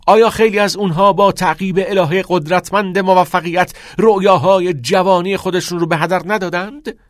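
loud, high, driving oratory; fervent classroom harangue; impassioned teacher. Acted. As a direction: challenge the boys, building to a shouted command.

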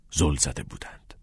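plain reading voice

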